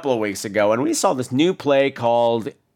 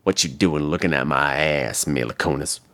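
very low, softened challenge; mock confrontation; 11 semitones lower than usual. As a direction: play at calling someone out, low and cool. Mock confrontation after slow turn.

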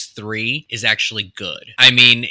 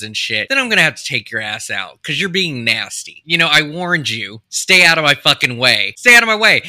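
angry threat; 4 dB louder than usual. Retold or imitated. Voicing Ralph's attacker threatening.

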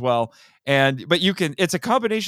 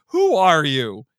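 imitating Belial; 3 dB louder, slower than usual. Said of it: "Belial asks him".

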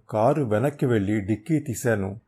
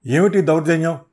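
loud, higher outraged cry; struggling protest; 5 semitones higher, 8 dB louder than usual. Acted. Thug dragged into the car, loud outraged protest. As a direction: protest while wrestling free. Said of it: "అని వాడు గింజుకుంటున్నాడు".

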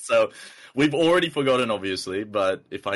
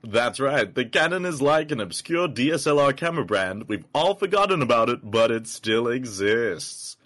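announcer voice; 4 semitones higher, 3 dB louder than usual. Acted. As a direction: boom it out like a show host. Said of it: "I might do my announcer voice".

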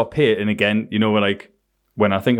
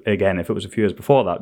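usual speaking voice

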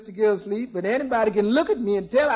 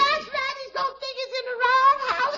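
much higher, giggling through the punchline; giggly; 9 semitones higher than usual. Acted. Maid giggling as she retells her wrong house joke to the boss. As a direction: bubble over with her own joke.

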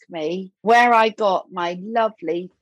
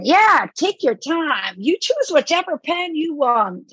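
put-on gentle to a child; 7 semitones higher than usual. Mark Hamill reassuring little Sophie, gentle higher voice. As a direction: coax a little girl patiently.

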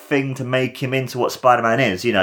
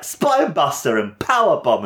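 lightly acted fan calling wrestling moves; mild mock cheer, pitched up; excited call-out; 7 semitones higher than usual. Calling out wrestling moves while watching.

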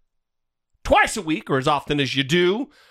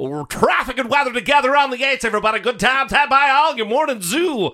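morning DJ voice; 4 semitones higher than usual. Acted. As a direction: hype it like a wacky morning zoo host. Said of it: "slip into D J mode".

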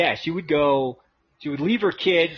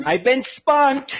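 mock tv host reveal; 7 semitones higher, slower than usual. Announcing the prank show.

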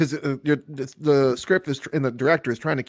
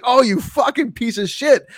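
mock outrage; 10 semitones higher, 4 dB louder than usual.

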